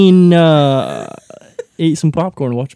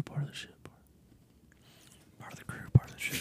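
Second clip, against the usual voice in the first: unvoiced whispered chant; whispering; 2 semitones lower, 19 dB quieter, faster than usual. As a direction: breathe the line out eerily. Whispered catchphrase repeated.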